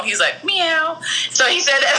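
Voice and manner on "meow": lightly imitated cat meow, a touch high; a chef's joking meow, half-mocked. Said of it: "the way he did it was, like, so low, like a real cat".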